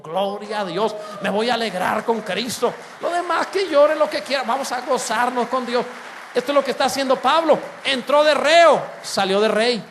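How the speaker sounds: loud, high triumphant proclamation; full-force preaching. Real, not acted. Preacher rousing the congregation with a loud triumphant cry. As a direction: shout the victory over death.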